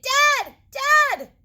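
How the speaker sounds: high, loud call for help; panicked shout. Acted. Bunny shouting for his dad in fear. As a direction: scream for dad. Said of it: "he shouted".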